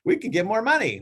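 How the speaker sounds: light up at the thought of money; mock eager sellers.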